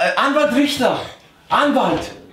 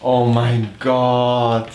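exasperated; 9 semitones lower, much slower than usual. Real, not acted. Reacts to guesses.

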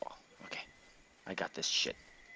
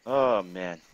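ordinary delivery

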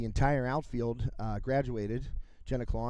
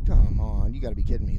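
exasperated; 4 semitones lower, 8 dB louder, faster than usual. Real, not acted. Audio gear blows away.

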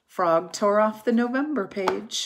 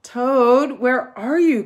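higher, loud call into the dark house; 5 semitones higher, 10 dB louder than usual. A narrator's reading. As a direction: call across the room. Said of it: "called Frog".

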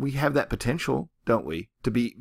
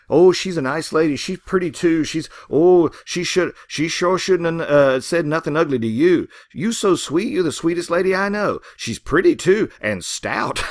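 flattering farmhands; 6 semitones higher, 5 dB louder than usual. Retold or imitated. Workers flattering Ruby, voiced.

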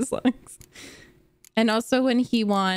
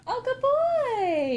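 very high, slow drawn-out praise; squeaky praising a dog; 12 semitones higher, much slower than usual. Real, not acted. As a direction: stretch out the praise sweetly.

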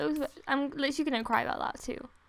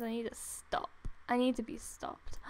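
ordinary delivery

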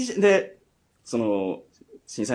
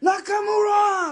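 mock MC shout. Retelling the MC's shout.

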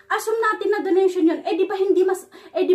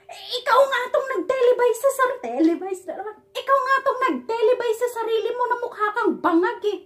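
heated mocking rant; 6 semitones higher than usual. Real, not acted. Ranting at the actor.

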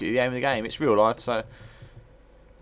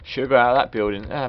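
ordinary delivery

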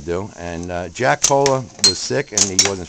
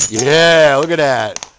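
excited cheer; 4 semitones higher, 8 dB louder, much slower than usual. Real, not acted. Cheering kid's shot.

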